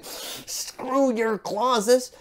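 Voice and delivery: very slow, drawn-out put-on voice; a slow, put-on voice dismissing the grammar; mock English teacher. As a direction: drag it out like a fed-up teacher. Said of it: "their own English teacher saying".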